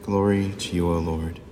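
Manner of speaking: normal talking voice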